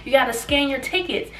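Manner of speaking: mock security lady stopping them; calling out.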